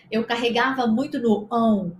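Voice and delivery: ordinary talk, nothing marked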